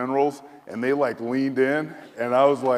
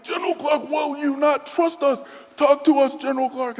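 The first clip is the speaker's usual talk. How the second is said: pleading shout; 5 dB louder than usual. Retold or imitated. General loudly pleading with the envoy to trust them. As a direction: beg him to trust them, loud and high.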